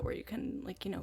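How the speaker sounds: ordinary delivery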